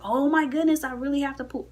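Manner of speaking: high-pitched re-enacted panic; retold urgency. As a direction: act out desperate urgency up high. Acting her urgent need in retelling.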